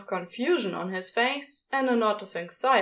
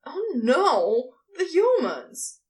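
louder, higher panicked cry; scream; 6 semitones higher, 4 dB louder, slower than usual. A narrator's reading. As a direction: shout it in sudden alarm. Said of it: "he screamed".

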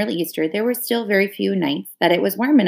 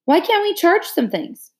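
higher, slow coaxing plea; wheedling; 10 semitones higher than usual. A narrator's reading. Ellie wheedling her parents for new clothes. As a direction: coax your parents sweetly. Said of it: "wheedling voice".

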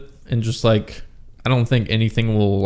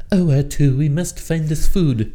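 mocking droid episode voice; 5 semitones higher than usual. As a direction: put on a silly high cartoon voice. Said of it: "They're like".

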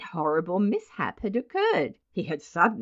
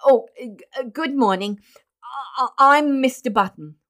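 halting stammer, pitched up; ashamed, nervous; 5 semitones higher, 6 dB louder than usual. A narrator's reading. Mister Button introducing himself to the nurse, nervous stammer. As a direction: stumble over the greeting, flustered and louder. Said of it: "Swallowing his shame".

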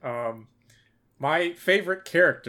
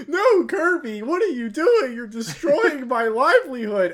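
mock panicked captains; 9 semitones higher than usual. Captains pleading with rampaging Kirby, high mock wail. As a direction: wail like a ruined shopkeeper. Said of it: "captains would be like".

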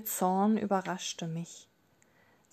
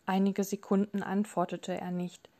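ordinary delivery

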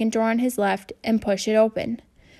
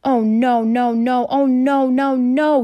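loud, despairing moans of no; groaning; 3 semitones higher, 6 dB louder, slower than usual.

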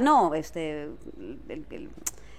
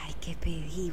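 whisper; 3 semitones lower, 4 dB quieter, slower than usual. Real, not acted. Trying to remember her order.

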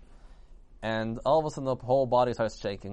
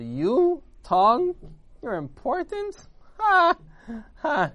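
high, incredulous jeering; pitched-up derisive taunting; mocking ridicule; 13 semitones higher, 7 dB louder, much slower than usual. A narrator's reading. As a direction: scoff with big fake laughs. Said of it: "making fun of the tongue".

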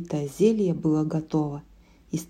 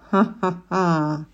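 witch cackle; slower than usual. A narrator's reading. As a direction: cackle in triumph. Witch laughs.

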